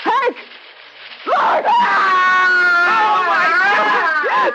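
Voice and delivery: high shocked cry; horrified cry. Acted. Wife watches the plant swallow Will, horrified cry. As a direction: cry out in horror.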